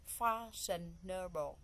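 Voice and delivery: "Fashionable" broken slowly into four syllables. Shown as a demonstration. Teacher counting out the four syllables of "Fashionable", syllable by syllable. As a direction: say "Fashionable" slowly, a gap after each syllable. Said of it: "So we have four syllable".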